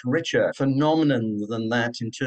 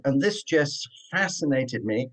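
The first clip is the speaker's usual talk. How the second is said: usual speaking voice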